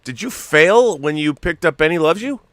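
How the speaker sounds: high, loud, stunned disbelief; can't believe the bargain hunter failed; shocked disbelief. Real, not acted. Co-host shocked at failed rating.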